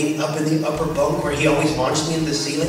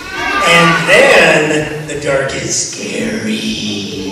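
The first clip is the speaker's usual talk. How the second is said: slow, drawn-out spooky tone; slow storyteller's creepy line; 7 dB louder, much slower than usual. Acted. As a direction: stretch it out to give the kids a shiver. Spooky turn in a kids' story.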